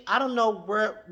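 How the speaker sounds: normal talking voice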